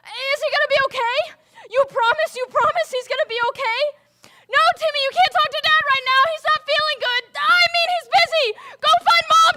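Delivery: loud, high, frantic cries for help; panicked shouting. Acted. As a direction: yell in panic as a parent crashes. Father's oxygen drops, she panics.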